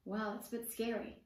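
ordinary delivery, nothing marked

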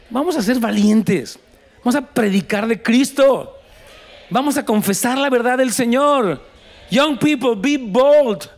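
put-on rally the young people to be bold; preacher urging the church to courage, full force; preaching at full force. Preacher exhorts congregation.

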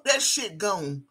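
normal talking voice

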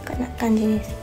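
ordinary talk

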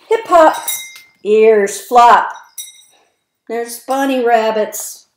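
bouncy, sing-song rhyming read-aloud; reading to children. A narrator's reading. Reading rhymes to young children, lively sing-song. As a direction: punch each rhyme for little listeners.

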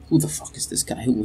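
hushed, breathy aside; mock whisper. Player mutters at the stranger, hushed. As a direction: mutter it under your breath in disbelief. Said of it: "you're like".

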